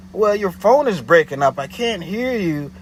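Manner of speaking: imitating the caller blaming his phone; mock excuse.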